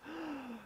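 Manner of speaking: sharp high gasp; mock gasp. Disciples hear of betrayal, high mock gasp.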